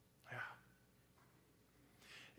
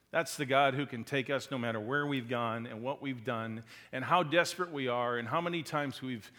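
ordinary delivery

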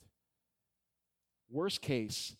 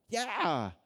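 excited yeah; 8 semitones higher, 8 dB louder than usual. Real, not acted. Urging congregation to be excited.

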